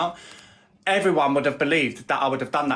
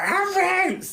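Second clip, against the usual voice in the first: mocking mum voice. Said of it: "her mum was like".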